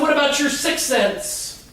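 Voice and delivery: put-on child voice.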